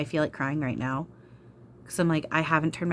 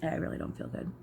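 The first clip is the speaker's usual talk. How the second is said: real queasy whisper, softer; nauseous whisper; 7 dB quieter than usual. Real, not acted. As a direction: breathe it out, feeling sick. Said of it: "I really feel like I'm about to be sick".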